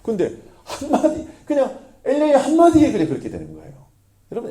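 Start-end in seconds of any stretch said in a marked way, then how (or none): 0.0-3.0 amazed. Real, not acted. Amazed exclamation, laughing.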